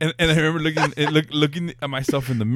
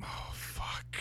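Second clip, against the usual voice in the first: whispered, appalled reaction; hushed dismay; 19 dB quieter than usual. Real, not acted. Listener hearing about the bleeding eye, hushed dismay. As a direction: breathe it out under your breath in dismay. Listener winces at the injury story.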